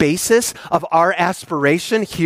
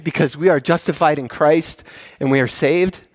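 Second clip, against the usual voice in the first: normal talking voice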